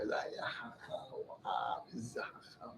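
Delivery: hushed, breathless wonder at the wise man; awed whisper. Retold or imitated. Speaker recalling the wise sage, whispering in awe. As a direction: breathe it out in awe, barely voiced.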